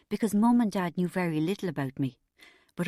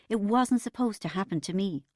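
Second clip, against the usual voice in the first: ordinary delivery, nothing marked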